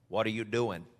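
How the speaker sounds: a hint of a deep Midwest preacher; deep-voiced pastor. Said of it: "Had a real deep voice".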